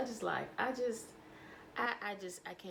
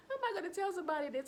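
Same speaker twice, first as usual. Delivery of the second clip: usual speaking voice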